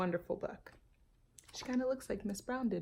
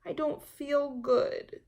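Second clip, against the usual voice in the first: put-on high, slow child's whine; a child dodging chores; reluctant girl pleading; whiny little girl; 8 semitones higher, 6 dB louder, slower than usual. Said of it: "Jamaica answered".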